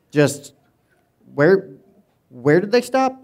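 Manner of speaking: high, loud, pretend-scandalised question; mock shock. Preacher feigning shock at the spies' stop, high and loud. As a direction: act shocked for the congregation.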